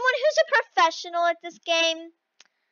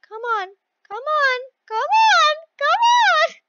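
rising higher and louder with each repeat; urgent urging; 8 semitones higher, 3 dB louder than usual. Real, not acted. Player urging the game on, escalating plea. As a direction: build from a plea to a high cry.

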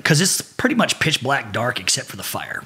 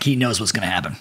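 usual speaking voice

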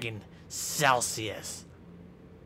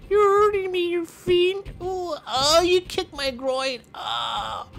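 much higher, pleading whimper; squeaky pained voice; 11 semitones higher than usual. Pretend. Beaten wrestler begging the Fiend in a much higher squeal. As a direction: squeal and beg like you're being hurt. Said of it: "This is my real voice".

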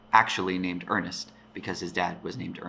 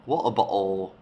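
Cockney "water bottle" with glottal t sounds; Cockney accent. Shown as a demonstration. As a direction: say "water bottle" like a Londoner from the East End. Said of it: "will maybe do a Cockney accent".

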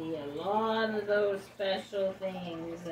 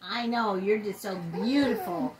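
pet talk voice; 4 semitones higher, 9 dB louder than usual. Real, not acted. Talking to the dog.

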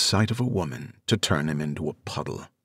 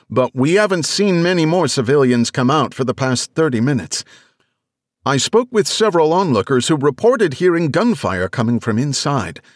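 higher, louder reporter over noise; strained field reporter; 6 semitones higher, 3 dB louder than usual. Acted. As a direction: push through background noise. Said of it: "The strained voice of the reporter cut in, struggling to enunciate over the background noise".

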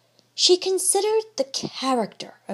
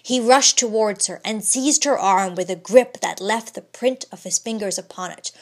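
plain reading voice

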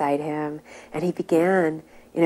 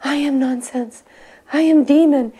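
high-pitched mock lament, growing louder; imitated tearful confession; 7 semitones higher, 5 dB louder than usual. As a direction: confess as the man starting to cry. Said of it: "started to kind of cry".

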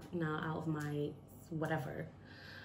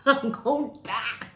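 loud, higher-pitched laughing outcry; mock panicked cry; 5 semitones higher, 10 dB louder than usual. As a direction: cry out in exaggerated doom. Same words cried out, laughing.

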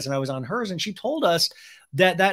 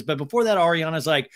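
ordinary delivery

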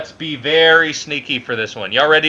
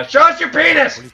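high, loud chanted taunt; hammed-up high-pitched jeering; taunting shout; 8 dB louder than usual. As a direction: yell it over and over to rattle him.

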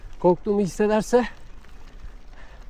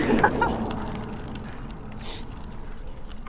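long breathy chuckle; soft airy laugh; 8 dB quieter than usual. Real, not acted. Rider chuckling after insulting the dog, breathy laugh. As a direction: let a quiet laugh run out.

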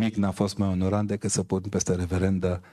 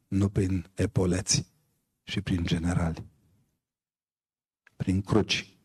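ordinary delivery

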